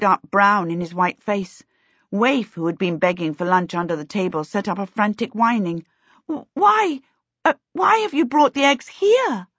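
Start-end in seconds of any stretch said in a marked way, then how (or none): 6.3-9.5 much higher, stammering and scared; girl barely gets the question out; frightened stammer. A narrator's reading. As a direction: force the words out through fear. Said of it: "Charmaine managed to say".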